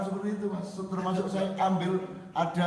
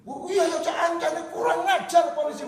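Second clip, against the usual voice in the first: much higher, louder excited yelling; mock shout; 10 semitones higher, 5 dB louder than usual. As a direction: shout it out, fired up. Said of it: "Oh, teriak mereka".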